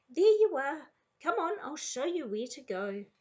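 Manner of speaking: loud, high-pitched, effusive; gushing welcome. A narrator's reading. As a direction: sweep the newcomer along with delight. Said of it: "Missus Burton gushed".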